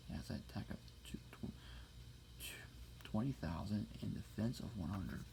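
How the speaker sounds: fast breathy stumbling whisper; whisper in left ear. Acted. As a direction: whisper it quickly close to the ear.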